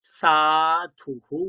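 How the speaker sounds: সাধুহু said very slowly, the final হু echoed as a separate breath; teacher demonstrating সাধুহু, slow and drawn out; drawn-out sadhuhu. Shown as a demonstration. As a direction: stretch সাধুহু out slowly so the visarga sounds as an echoed হু. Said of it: "তাহলে উচ্চারণ কেমন হবে?".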